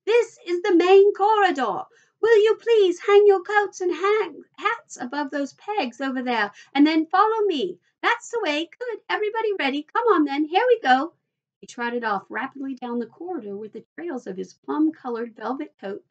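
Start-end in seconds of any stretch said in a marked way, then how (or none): 0.1-11.1 high, loud host calling out instructions; raised voice. A narrator's reading. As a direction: project over the noise to the whole party. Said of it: "raising his voice above the noise".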